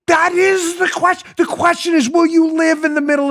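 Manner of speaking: high, theatrical Shakespeare echo; lightly hammed Hamlet line; mock Hamlet. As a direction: slip back into the stagey voice. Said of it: "So they're doing Hamlet".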